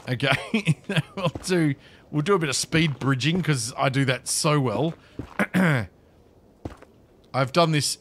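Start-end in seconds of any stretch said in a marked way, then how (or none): none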